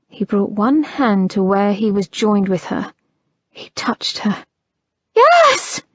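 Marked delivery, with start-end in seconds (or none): 5.2-5.8 very high loud scream. Acted. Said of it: "she screamed".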